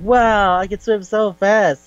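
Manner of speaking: high, slow mock amazement; a hammed-up wow; amazed girl. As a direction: gush like a thrilled kid. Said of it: "the girl goes like".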